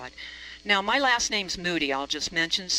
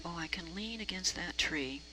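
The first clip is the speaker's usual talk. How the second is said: whisper; 2 semitones lower, 8 dB quieter than usual. Retold or imitated. Private thought while hiding behind tree.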